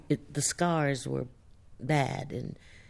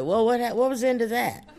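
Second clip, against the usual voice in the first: higher, louder, laughing bewildered question; puzzled reader; 8 semitones higher, 5 dB louder, faster than usual. Retold or imitated. Author imitating confused readers of Song of Solomon, higher and louder. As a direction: play a baffled reader demanding the ending. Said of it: "they said".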